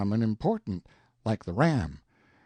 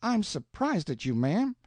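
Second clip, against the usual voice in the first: mildly hurt; 8 semitones higher than usual. A narrator's reading. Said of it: "He looked kind of hurt".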